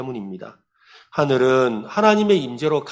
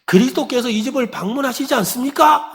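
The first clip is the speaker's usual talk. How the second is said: high, raised voice for poor hearing; acted pastor, high-pitched insistent question; shouting into an ear; 11 semitones higher, 3 dB louder than usual. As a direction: call it right into the old woman's ear. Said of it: "귀에다 대고".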